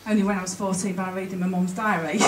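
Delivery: ordinary delivery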